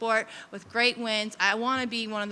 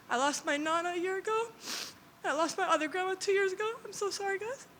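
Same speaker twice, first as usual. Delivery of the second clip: real tears while naming her lost grandmas; crying; 9 semitones higher than usual. Real, not acted. Fighter to the interviewer, breaking down in tears over her lost grandmas. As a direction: voice breaking into tears, pitched higher. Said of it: "I'm gonna cry now".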